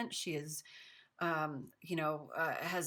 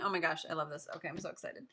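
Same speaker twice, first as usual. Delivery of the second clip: ordinary talk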